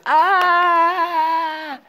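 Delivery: long, high-pitched held "aah"; acted tantrum yell. Teacher shows the class how he used to scream, mock tantrum yell. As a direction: yell like a spoiled child, high and sustained. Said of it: "yo tiraba cosas".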